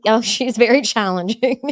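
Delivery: laughing. Real, not acted. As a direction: laugh through it fondly. Laughing about daughter.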